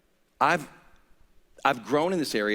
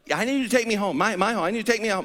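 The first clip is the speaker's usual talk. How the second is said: urgent plea; 8 semitones higher, much faster than usual. Retold or imitated. Pleading with sound man.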